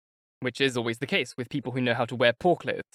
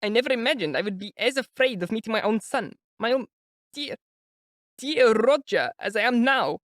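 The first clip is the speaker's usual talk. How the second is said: high, anxious mother's voice; an older woman fretting before meeting her son; high, worried mother voice; fretful mother; 9 semitones higher than usual. A narrator's reading. Pulcheria's fretful speech.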